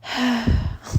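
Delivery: little sigh. Shown as a demonstration. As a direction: let out a small tired sigh. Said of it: "make little, sighs and stuff".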